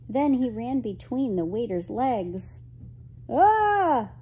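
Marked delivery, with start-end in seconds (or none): 3.3-4.1 high, loud "Ah!"; startled cry. Acted. Waiter tripped by a dog drops a plate, startled cry. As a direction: yelp as if knocked off balance. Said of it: "cried the waiter".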